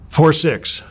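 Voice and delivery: imitated stopwatch call; called-out time. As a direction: announce it high and loud like a timer. Coaches calling out his sprint time.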